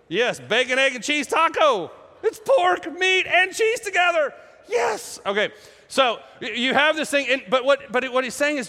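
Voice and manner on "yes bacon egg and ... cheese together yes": high, mock-jubilant food praise; gleeful celebration. Preacher celebrating bacon and cheese tacos, gleefully exultant. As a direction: exult in it, voice leaping right up. Cheering over a cheeseburger allowed.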